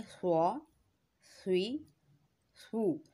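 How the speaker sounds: स्व स्वी सू full and thick, no hiss; स्व स्वी सू with a heavy, rounded s; heavy swad, no whistle. Shown as a demonstration. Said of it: "स्वाद में हम सीटी की आवाज़ नहीं निकालेंगे". (स्व स्वी सू पुर और मोटा)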